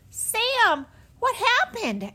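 high, loud, worried shout; alarmed call. A narrator's reading. Jessie calls anxiously to Sam.

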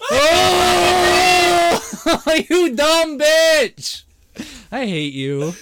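high, drawn-out exasperated wail; outraged, half-laughing cry; heated outburst. Real, not acted. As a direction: howl the insult slowly with a laugh breaking in. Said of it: "I'm fucking heated".

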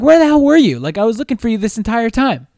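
high, loud exasperated demand; retold angry outburst. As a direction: blow up at her like you searched for ages. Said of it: "So I'm like".

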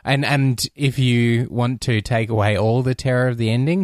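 normal talking voice

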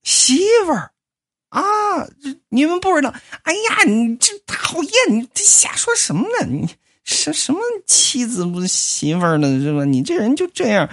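new bride embarrassed in front of the family; coy girlish wife. Acted. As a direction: act shy and flattered. Susie coy at being introduced as wife.

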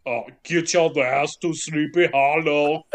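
slow, thick Austrian accent; Arnold Schwarzenegger. Shown as a demonstration. Podcaster on request sending someone off, Austrian accent impression. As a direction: do an action-star Austrian accent, drawn out. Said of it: "do it like Arnold Schwarzenegger".

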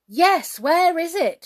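high, loud crowd-like shout. A narrator's reading. Everybody backing up the king, shouting. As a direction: call out the demand forcefully. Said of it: "shouted everybody".

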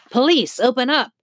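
high, loud command at the door; police shout. A narrator's reading. Police officer pounding on the door, shouting loudly. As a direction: bark it like a cop at a door. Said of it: "shouted a voice".

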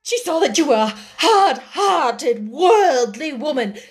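high, loud, overwrought accusation; emphatic, worked-up outpouring; hysterical tirade. A narrator's reading. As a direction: rail at your sister, close to hysterics. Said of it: "made her hysterical enough".

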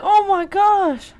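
high, loud, drawn-out gasping disbelief; shocked. Retold or imitated. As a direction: stretch out the retold astonishment. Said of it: "so shocked".

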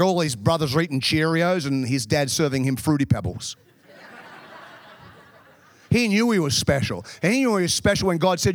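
ordinary talk, nothing marked

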